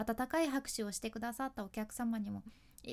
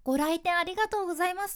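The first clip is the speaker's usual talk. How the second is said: cheerful store greeting; 7 semitones higher, 6 dB louder, faster than usual. Shown as a demonstration. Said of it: "形式的になってくると".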